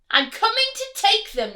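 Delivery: high, loud greedy yell; rat thief yells. Acted. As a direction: yell it into the cave. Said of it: "the rat thief yelled".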